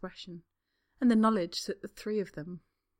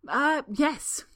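awkward; 8 semitones higher, 8 dB louder than usual. Acted. Draco awkwardly plays along.

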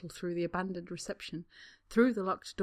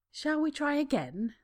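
higher, sweet but menacing; sugary threat; false kindness; 8 semitones higher than usual. A narrator's reading. Spa woman asking Draco with false kindness. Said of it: "asks with false kindness".

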